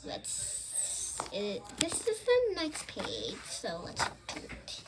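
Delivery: quick whisper off the story; whispered aside. Real, not acted. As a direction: hush it as a side note. Aside to child about page.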